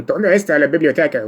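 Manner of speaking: high, loud bandit-movie Mexican accent. Shown as a demonstration. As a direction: ham up the Western bandit Spanish. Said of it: "when I was speaking Spanish".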